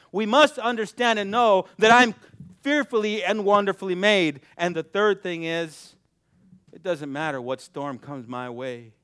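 ordinary talk, nothing marked

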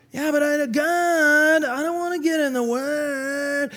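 high, drawn-out whiny protest; hammed-up childish balking; mock whining. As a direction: whine back slowly, stretching the last word. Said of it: "Stop your whining".

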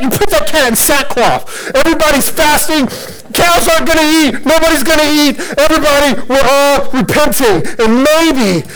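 high, frantic, rushed royal decree; panicked ruler rattling off wild orders; king freaking out. Acted. Preacher acting out Nineveh's king as he orders repentance, freaking out. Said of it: "the king freaks out".

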